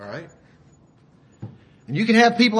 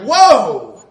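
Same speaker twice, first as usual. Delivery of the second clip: startled whoa; 9 dB louder than usual. Retold or imitated. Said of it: "and you're like".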